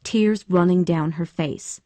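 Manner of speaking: ordinary delivery, nothing marked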